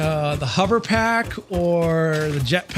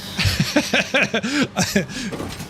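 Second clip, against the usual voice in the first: high burst of laughter; amused laugh; 7 semitones higher than usual. Real, not acted. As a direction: crack up at the joke. Amused by the voiced chat message.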